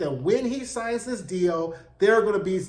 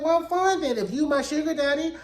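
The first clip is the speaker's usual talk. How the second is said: high-pitched, put-on compliance; sassy mock acceptance; 7 semitones higher than usual. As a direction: give in with a high, sassy lilt. [name] sassily accepts Fred's terms.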